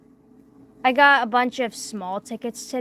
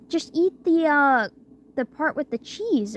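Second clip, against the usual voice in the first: pushy cook; 6 semitones higher, 3 dB louder than usual. Retold or imitated. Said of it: "he's like".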